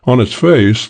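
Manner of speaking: ordinary delivery, nothing marked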